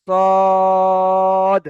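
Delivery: সদ with a thick held vowel; long sad. Shown as a demonstration. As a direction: draw out the vowel for three alifs. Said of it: "তিন আলিফ পরিমাণ টান হবে". (তিন আলিফ টেনে সদ)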